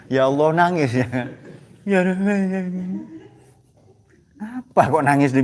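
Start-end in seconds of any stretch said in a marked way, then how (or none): 1.8-3.0 high, hammed-up lament; mock tearful plea, higher; mock wailing preacher. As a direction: imitate a preacher faking tears. Mock crying preacher at microphone.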